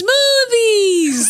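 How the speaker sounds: high, drawn-out mock holler; imitated movie shout. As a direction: belt out SMOOTHIES like the character. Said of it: "That's what she says".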